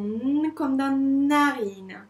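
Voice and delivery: N held as a long nnn, stretched into narine; letter sound demo. Shown as a demonstration. Teacher linking letter to narine, prolonged N sound. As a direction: hum the N before narine. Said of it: "fait bien le son".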